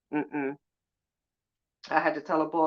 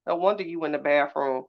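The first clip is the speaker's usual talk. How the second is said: ordinary talk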